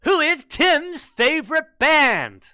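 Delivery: high, loud, slow game-show host; excited. Acted. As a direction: sell the question like a big reveal. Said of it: "let's get excited for question seven".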